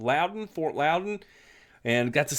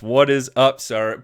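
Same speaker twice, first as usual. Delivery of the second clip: ordinary delivery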